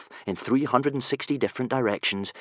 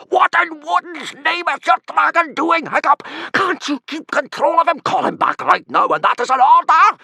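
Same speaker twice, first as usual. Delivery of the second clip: much higher, louder furious order; furious screech; 9 dB louder than usual. Acted. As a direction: scream at the boy to control his dragon. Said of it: "screeched Gobber in a furious".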